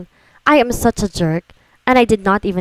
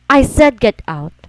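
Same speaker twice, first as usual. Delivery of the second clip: firm angry order; 4 semitones higher, 4 dB louder, slower than usual. Acted. Repeats order, louder, slower.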